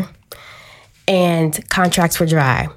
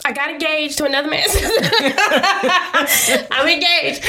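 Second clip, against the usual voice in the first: laughing; 8 semitones higher, faster than usual. Real, not acted. Laughing relief at good news.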